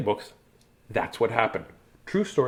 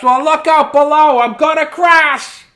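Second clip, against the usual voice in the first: higher, louder playful crash cry; hammed-up warning shout; mock alarm; 6 semitones higher, 5 dB louder than usual. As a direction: yell it like a falling mouse. Mouse crashing in story.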